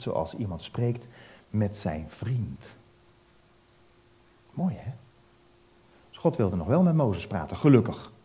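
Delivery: ordinary delivery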